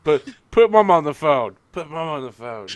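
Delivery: game sniper in mock Australian, loud at first; Australian sniper impression. Quoting a game sniper at the table, mock Australian. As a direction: demand it in a bad Aussie accent. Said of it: "that's my Team Fortress Two sniper".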